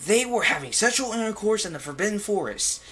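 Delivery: mock furious tattle; hammed-up angry headmaster; furious yell. A narrator's reading. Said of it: "he yelled in a furious voice".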